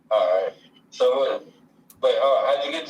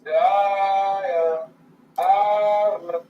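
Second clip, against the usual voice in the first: high pitched voice effect; 6 semitones higher, 4 dB louder, much slower than usual. Real, not acted. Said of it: "No, it's like the devil".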